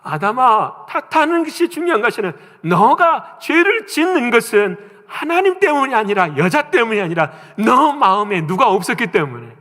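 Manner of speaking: loud, high rebuke from God; God scolding Adam. Retold or imitated. God rebukes Adam, loud stern scolding. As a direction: scold him firmly from above. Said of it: "그 다음에 아담을 혼내시거든요".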